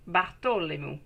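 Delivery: Bartholomew said the French way, with a T for the TH; French pronunciation. Shown as a demonstration. Reader trying out a client's name in a French pronunciation. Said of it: "It's better when I say it in French".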